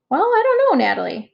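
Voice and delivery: put-on lifted high, gentle adult indulging a child; kind teacher voice. Mister Purdy voiced by mom.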